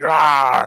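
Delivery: imitated animal roar, short and sudden; skunk's attack snarl. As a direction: mimic a clawing beast's "rah". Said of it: "It was like".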